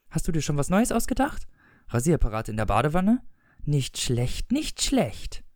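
plain reading voice

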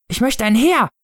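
put-on high child's voice; eager child. A narrator's reading. Child seizing the chance to wish, high eager voice. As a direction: jump in with a high, eager demand. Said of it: "antwortete es schnell".

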